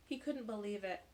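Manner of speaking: ordinary delivery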